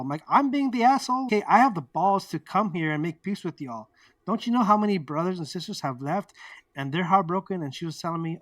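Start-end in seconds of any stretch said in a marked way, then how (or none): 0.3-7.6 heated, retold indignation; indignant comeback. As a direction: act out an outraged rebuttal. Acted quarrel, indignant comeback.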